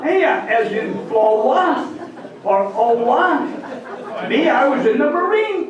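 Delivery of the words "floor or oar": slow, drawn-out Flowa, ending in "wuh" without R; Maine pronunciation. Shown as a demonstration. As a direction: stretch Owa into OH-wuh. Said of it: "are pronounced".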